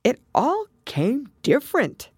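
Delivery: high, musing repetition; thoughtful. A narrator's reading. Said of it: "the Mock Turtle repeated thoughtfully".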